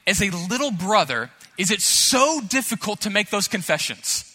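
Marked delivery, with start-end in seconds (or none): none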